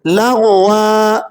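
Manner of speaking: لغوا with the closing ا drawn out slowly; long final a. Shown as a demonstration. Teacher pausing on لغوا with a long vowel. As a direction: stretch the ا on the stop. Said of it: "تھوڑا سا کھینچ کر پڑھ رہے ہیں".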